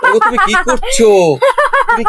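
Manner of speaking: high-pitched startled cry; alarmed protest. Acted. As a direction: call out in alarm. Parent alarmed at hurt child.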